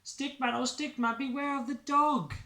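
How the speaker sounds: high, loud sing-song warning; warning refrain. Acted. Narrator warns Stickman of danger, high sing-song call.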